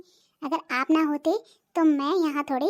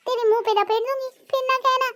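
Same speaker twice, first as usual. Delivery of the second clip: raised, higher-pitched taunt; mocking threat; 7 semitones higher than usual. Wife threatening the woman behind, high mocking threat. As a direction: threaten her in a high, scornful voice.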